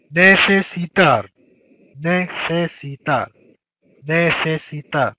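Necesitar stretched out syllable by syllable; teacher drawing out Necesitar; slow drilled word. Shown as a demonstration. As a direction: drag out Necesitar slowly. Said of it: "repeats verb slowly for class".

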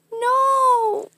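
high, drawn-out wail of no; pup's high-pitched protest; acted dismay. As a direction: cry out in a squeaky, loud protest. Pup protests at a parting.

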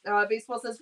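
ordinary talk, nothing marked

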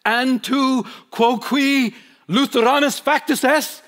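loud, slow anguished cry in Latin. Retold or imitated. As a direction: cry out in Latin like the shocked bishop. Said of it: "And he cries out".

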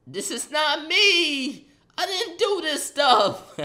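high, loud, slow whiny denial; whiny protest. Retold or imitated. Son wailing his innocence to mom. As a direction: stretch it out like a wailing kid.

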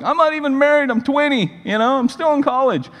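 high-pitched flustered protest; mock panic. Twenty-year-old youth pastor overwhelmed by marriage call, mock panic.

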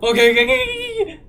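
high, loud frantic repeating; panicked. Real, not acted. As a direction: gasp it out in panic. Panicking player.

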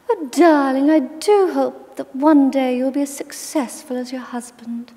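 put-on high, sweet, girlish voice hiding a barb; sugary put-down from a posh old lady; girlish posh grandmother. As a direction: voice it high and sweet while being cruel. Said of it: "she had a very girlish little voice like that".

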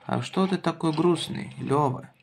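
normal talking voice

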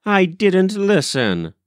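high-pitched indignant denial. A narrator's reading. Molly accused of listening at the window, indignant. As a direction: deny it as an affronted child, high. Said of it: "said Molly indignantly".

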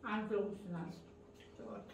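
talking with bread in mouth; crusty bread. Real, not acted. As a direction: speak through a mouthful of bread. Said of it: "Ağzımda ekmek vardı".

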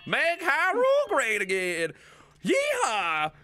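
high, drawn-out country whoop; yeehaw-style country yell; hammed-up rally holler. Rallying cry in the country character.

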